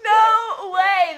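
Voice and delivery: high, loud shocked outburst; stunned disbelief. Retold or imitated. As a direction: blurt it out, can't believe it. Retold shock at the news.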